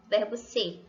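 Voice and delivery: usual speaking voice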